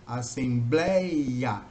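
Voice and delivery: assembleia stretched syllable by syllable, open é in blé; drawn-out syllables. Shown as a demonstration. Teacher showing students the open é in assembleia, slowly drawn out. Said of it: "ou seja".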